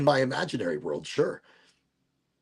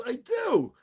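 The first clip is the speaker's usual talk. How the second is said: mock proud shout; 10 semitones higher than usual. Mock boast answering the quote.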